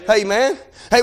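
ordinary talk, nothing marked

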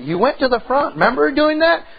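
voicing her mom. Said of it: "my mom said".

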